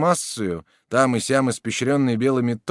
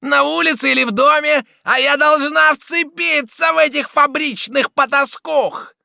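shrew's shout; 7 dB louder than usual. A narrator's reading. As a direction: screech it at the whole gang. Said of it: "кричала Мегера".

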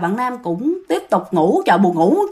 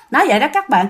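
ordinary delivery, nothing marked

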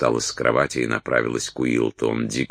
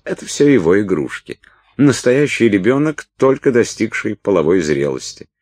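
disdainful; 8 semitones higher, 5 dB louder than usual. A narrator's reading. Уилд морщится, презрительная реплика.

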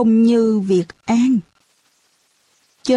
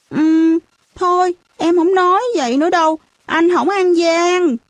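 put-on high, placating little-kid voice; pleading child; 7 semitones higher than usual. A narrator's reading. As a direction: give in and coax a sulking playmate. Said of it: "đợi cho nó năn nỉ cả chục lần".

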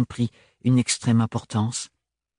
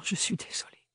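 an unvoiced whisper, much softer; chuchoté; 11 dB quieter than usual. A narrator's reading. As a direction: whisper it almost without breath. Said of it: "chuchota-t-elle encore plus bas". (chuchoté très bas)

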